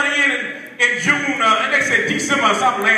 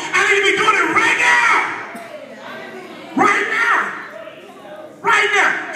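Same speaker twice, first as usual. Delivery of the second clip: preaching at full force; 4 semitones higher than usual. Acted. Pastor whooping at the church. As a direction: preach it hard to the congregation.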